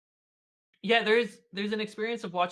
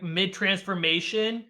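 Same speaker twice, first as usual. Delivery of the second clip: ordinary delivery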